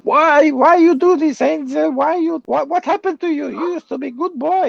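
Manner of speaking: imitating an old Greek lady scolding; old Greek woman. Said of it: "this old Greek woman chase me around".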